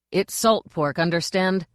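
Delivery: usual speaking voice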